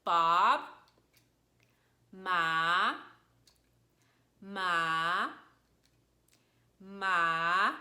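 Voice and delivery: "Bob" and "ma" drawn out and rising like a question; questioning upward glide on "ma"; second tone. Shown as a demonstration. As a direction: slide each "ma" upward, loudly. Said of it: "It sounded like a question".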